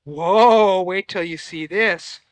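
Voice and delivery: ordinary delivery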